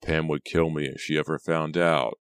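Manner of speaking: ordinary delivery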